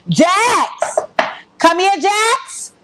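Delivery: very high, slow sing-song call of Jax. Retold or imitated. Teasing Jax over her braids, very high slow call. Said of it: "calling Jax".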